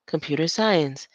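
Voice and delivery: ordinary talk, nothing marked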